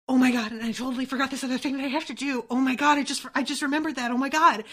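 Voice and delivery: re-enacted fast, mounting panic; mock-frantic self-imitation; overwhelmed. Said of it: "I feel really overwhelmed".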